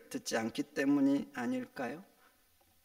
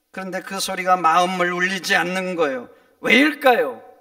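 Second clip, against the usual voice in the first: impassioned preaching; 14 dB louder than usual. Real, not acted. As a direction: build to a loud rhetorical question. Preaches at full force.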